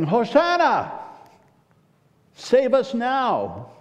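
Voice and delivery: high, loud joyful cry, drawn out on save us now; cheering crowd shouting praise. Retold or imitated. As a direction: shout it up over a festival crowd. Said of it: "they're shouting".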